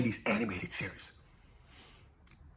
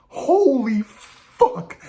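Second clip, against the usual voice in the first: slow stunned exclaim; 7 semitones higher, much slower than usual. Real, not acted. Excited about Reed Richards cameo.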